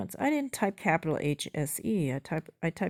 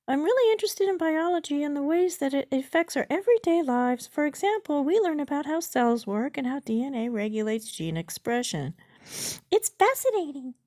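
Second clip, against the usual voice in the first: high, louder bot character; chatbot voice; 9 semitones higher, 5 dB louder than usual. Acted. Chatbot sidetracks user's question into biology, high bot voice. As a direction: read the bot's reply high up.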